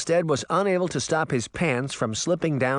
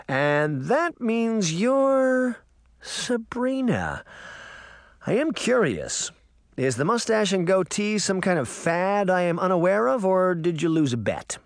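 teasing uncle; 8 semitones higher than usual. Acted. Uncle Jake turns to Sabrina, teasing in a raised voice. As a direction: tease the niece with mock curiosity, voice lifted.

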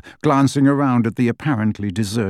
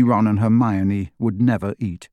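plain reading voice

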